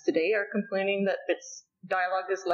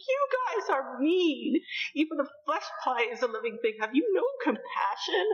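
mock childish scolding; 7 semitones higher than usual. Kagura scolds the boys over the beetle, whiny girl voice. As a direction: scold them like a hurt little girl. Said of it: "she's like".

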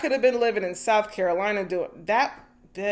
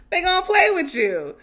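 laughing; 9 semitones higher than usual. Real, not acted. Laughing punchline about people playing you.

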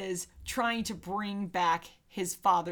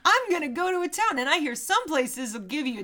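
put-on wholesome 1950s kid; 5 semitones higher, 4 dB louder than usual. Hitchhiker kid voiced in retelling.

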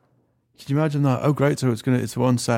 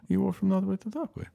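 raised, sing-song tourist explanation; higher mock tour guide; 9 semitones higher, 4 dB quieter than usual. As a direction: lift it up like a bored guide. Mocking tour guide, repeated higher.

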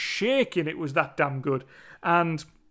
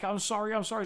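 softly pleading sorry; 2 semitones higher, 6 dB quieter, much faster than usual. Retold or imitated. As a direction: mumble the apology softer, like a scared kid. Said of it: "because Davey's like".

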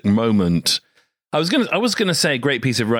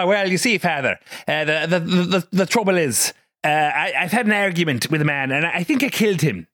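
a light Southern Irish lilt on a confession; amateur Southern Irish accent, confessing to a priest; 6 semitones higher than usual. Retold or imitated. Podcast host trying a Southern Irish accent on a voice coach. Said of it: "That's an excellent Southern Irish accent".

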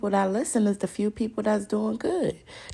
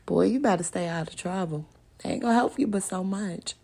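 normal talking voice

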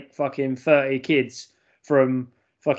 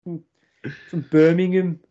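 Brummie accent; 4 semitones higher than usual. Shown as a demonstration. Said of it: "start talking a little bit like this".

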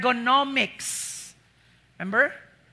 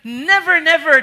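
loud, high double never; forceful repeated never; emphatic never; 5 semitones higher, 9 dB louder than usual. Retold or imitated. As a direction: hammer home each never. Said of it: "It's like".

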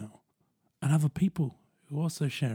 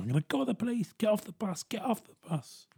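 mock angry driver, pitched higher; imitating the bus driver; 10 semitones higher, 5 dB quieter than usual. Imitating the driver ordering a passenger off the bus. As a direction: play the driver ordering him off, a light mock. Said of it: "the bus driver going".

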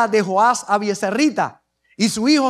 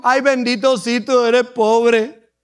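slow, drawn-out commiseration; hammed-up poor-you sympathy; mock pity; slower than usual. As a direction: stretch it out as if pitying someone. Mock pity God did not show.

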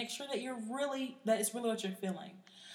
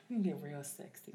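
seductive voice; 6 semitones lower, 6 dB quieter than usual.

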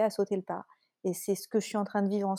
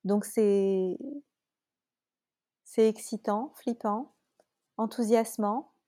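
ordinary talk, nothing marked

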